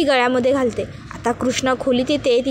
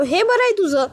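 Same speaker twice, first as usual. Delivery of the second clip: exasperated retort; 7 semitones higher than usual. A narrator's reading. Husband exasperated.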